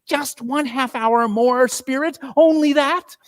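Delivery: put-on high, pleading old man's voice, rising to a cry; an old miser begging like a child to stay. A narrator's reading. Scrooge begs the Spirit to stay a little longer, high childlike pleading. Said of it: "begged like a child".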